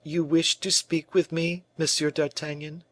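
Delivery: high, strained, hurt; broken by anger and pain. A narrator's reading. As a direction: hold back pain and anger as you ask. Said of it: "in a voice broken by anger and pain".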